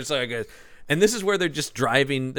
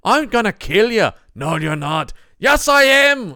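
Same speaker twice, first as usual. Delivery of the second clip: mock action-movie banter; 10 semitones higher, 7 dB louder than usual. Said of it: "it's all just like".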